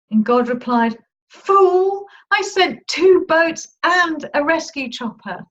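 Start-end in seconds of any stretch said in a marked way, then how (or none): 1.3-5.4 God scolding. Retold or imitated. God answers the drowned preacher.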